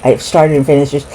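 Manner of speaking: usual speaking voice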